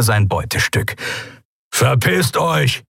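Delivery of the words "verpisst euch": slow menacing rumble; gruff growl. A narrator's reading. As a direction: growl a threat, slowly. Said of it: "brummte er".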